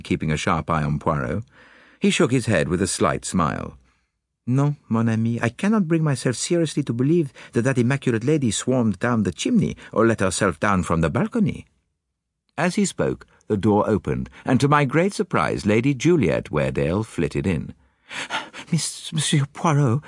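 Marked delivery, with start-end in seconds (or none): 4.5-11.7 put-on higher, accented detective voice; Poirot. Detective confiding little ideas to Hastings, higher accented voice. Poirot's dialogue in narrated story. 18.7-20.0 high, breathless lady; somewhat breathless. A narrator's reading. Lady arriving to see the detective, somewhat breathless. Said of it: "she said somewhat breathlessly".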